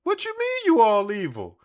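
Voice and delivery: high, loud disbelieving cry; shocked high yelp. Retold or imitated. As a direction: squeal it in disbelief. Voicing Davy, shocked.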